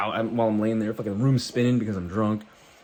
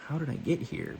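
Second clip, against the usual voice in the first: put-on girl's voice; 7 semitones higher, 5 dB quieter than usual. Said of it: "like, this girl. She's like".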